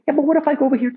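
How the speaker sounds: high mock actor voice; playing the actor. Actor arguing in the Python bit, high cheeky voice.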